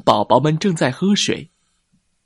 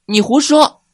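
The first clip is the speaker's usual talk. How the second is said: much higher, louder protest; indignant child retort; 13 semitones higher, 6 dB louder than usual. Acted. A baby ankylosaur scolding the boastful one, indignantly. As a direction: snap back like an offended little sibling.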